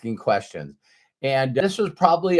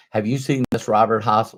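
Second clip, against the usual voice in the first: ordinary talk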